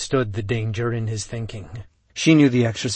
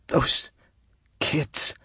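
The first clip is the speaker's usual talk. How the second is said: voice breaking; 5 semitones higher than usual. Acted. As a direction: voice cracks, trailing off to a breath. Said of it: "His voice broke and trailed off".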